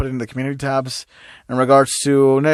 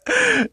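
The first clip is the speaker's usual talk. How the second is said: high giggle. Real, not acted. Laughing mid-sentence.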